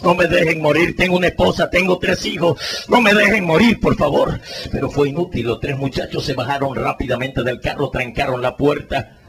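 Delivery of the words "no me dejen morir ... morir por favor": suplica cada vez más agudo y fuerte; súplica desesperada. Retold or imitated. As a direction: ruega por su vida con angustia. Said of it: "pidiéndole auxilio". (herido suplica a los muchachos que no lo dejen morir, desesperado)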